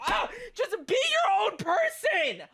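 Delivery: very high anguished yell; crying shout. Real, not acted. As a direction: scream it close to tears. Ranting breaks into yelling.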